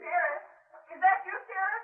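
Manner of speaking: urgent call. Acted. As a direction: shout to find someone in the night. Calls out in the dark.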